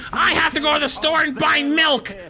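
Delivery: high, loud mock old-lady complaint; angry old lady. Old lady demanding service at closing, high mock outrage. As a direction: whine outraged about needing milk. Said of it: "And she gets so angry".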